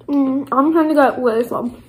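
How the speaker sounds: chewing while talking; muffled through food; mouth full of cookie. Real, not acted. Seen 4: Bites cookie at 2.2 and 4.2; pursed lips, chewing throughout.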